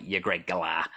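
light Australian twang on a stock phrase; Australian accent. Shown as a demonstration. Host shows the phrase that starts his accent, Australian accent. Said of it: "Australian, for example, I'll always say something".

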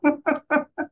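short high-pitched laugh; a quick high giggle. Real, not acted. Laughs at being put in the back.

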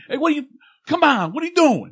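high, loud, fast Brooklyn bluster; mock-angry Brooklyn guy sounding off; high, loud and fast hammed-up Brooklyn rant; Brooklyn accent. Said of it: "I get Brooklyn".

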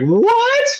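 can't believe it; shocked. Retold or imitated. Tommy told to stop climbing, high shocked cry. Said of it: "He's like".

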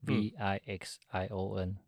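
slow, letter by letter: "V I X I O N"; spelling it out. Shown as a demonstration. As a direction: spell "V I X I O N" one letter at a time. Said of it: "Doctor Vision 怎么拼？".

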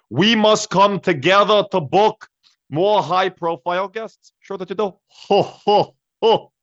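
rally the crowd, finger wagging; loud high Bernie voice; Bernie Sanders impression. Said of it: "Bernie Sanders".

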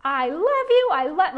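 squealing high, loud delight; Buddy the Elf's giddy welcome. Retold or imitated. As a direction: gush like an overjoyed elf. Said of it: "he's Buddy the Elf".

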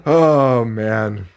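ordinary talk

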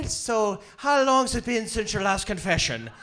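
put-on Irish brogue; Irish accent. Shown as a demonstration. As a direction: show off a hammy Irish accent. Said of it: "I speak Irish, uh, Scottish".

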